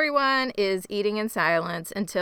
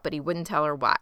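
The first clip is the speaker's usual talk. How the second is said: ordinary talk, nothing marked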